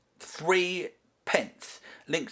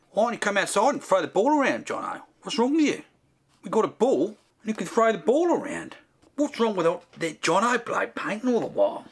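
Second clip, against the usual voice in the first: lively Australian bloke, pitch jumping high; heckling Australian mate; 5 semitones higher, faster than usual. Acted. Australian mate badgers the painter to come outside, teasing.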